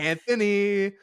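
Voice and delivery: high, loud name call; drawn-out shout for someone absent; playful yelling. Pretend. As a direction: holler the full name down the hall. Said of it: "Keep yelling".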